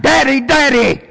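high pleading cry of daddy; a child's plea, pitched high. Retold or imitated. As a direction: call out to the father, pleading. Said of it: "So Jesus cried out".

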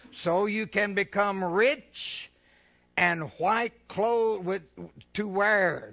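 slow, loud pulpit reading; slow loud preacher reading Revelation; solemn scripture reading. Acted. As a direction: proclaim each phrase slowly. Scripture read.

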